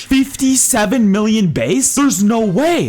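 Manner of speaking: high, stunned disbelief; real excited astonishment. Real, not acted. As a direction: exclaim as if you cannot believe your eyes. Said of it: "I'm actually stunned right now".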